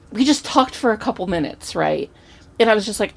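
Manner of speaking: ordinary talk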